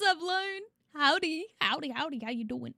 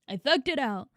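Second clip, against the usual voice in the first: ordinary talk, nothing marked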